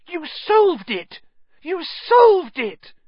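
high, loud, drawn-out cheer; excited screaming. A narrator's reading. Hermione runs towards Harry, screaming with excitement. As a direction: scream it joyfully, stretching the key word.

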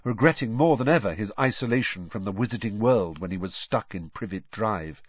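usual speaking voice

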